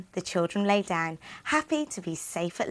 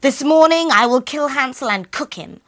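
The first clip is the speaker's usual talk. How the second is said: witch threat; 6 semitones higher, 9 dB louder than usual. Acted.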